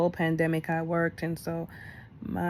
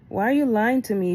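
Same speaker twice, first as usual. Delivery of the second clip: angry; 4 semitones higher, 5 dB louder than usual. Retold or imitated. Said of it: "I was so angry with him".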